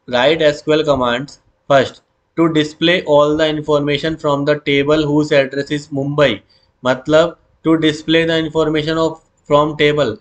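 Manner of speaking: very slow, word by word; slow dictation. Shown as a demonstration. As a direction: spell it out for students to copy. Teacher reading question aloud.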